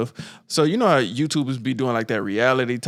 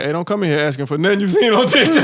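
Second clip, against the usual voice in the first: raised, louder sign reading; mock stern warning; 7 semitones higher, 6 dB louder, faster than usual. Waffle House sign warning customers, raised mock-stern voice. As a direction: call it out as an emphatic warning.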